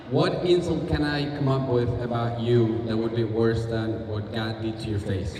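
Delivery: ordinary talk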